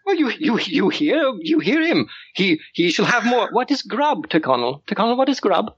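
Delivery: high, indignant little aristocrat; fussing child lord. Acted. Young lord fusses over the hungry guard, high and outraged. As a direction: fret shrilly about the poor fed brute.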